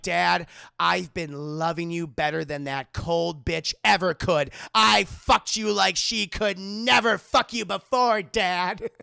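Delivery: loud, slow melodramatic delivery; impassioned declaration. Retold or imitated. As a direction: proclaim it with heavy, hammed-up force. Retold son's impassioned line to father.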